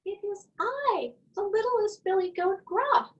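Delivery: squeaky high little billy goat; tiny goat voice. A narrator's reading. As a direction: pitch way up like the smallest goat.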